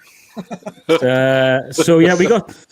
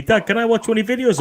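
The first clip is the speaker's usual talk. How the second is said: put-on higher-pitched kid asking dad; imitating his son, pitched up; son pleading; 6 semitones higher than usual. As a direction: voice a young lad's hopeful request. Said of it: "my lad keeps saying to me".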